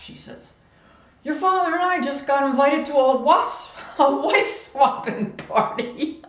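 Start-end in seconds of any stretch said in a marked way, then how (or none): 1.2-6.2 in a huff. Retold or imitated. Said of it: "She was in a huff".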